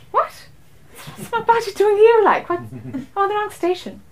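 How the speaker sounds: high, bewildered what-am-I-doing-here; baffled disbelief. Retold or imitated. Stranded traveller baffled at the wrong station, high and bewildered. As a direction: squeak out the confusion.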